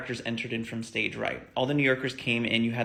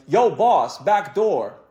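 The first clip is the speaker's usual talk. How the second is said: loud, higher shout to the front; calling out; 5 semitones higher, 7 dB louder, much slower than usual. Retold or imitated. Guy at the back yelling to the bus driver.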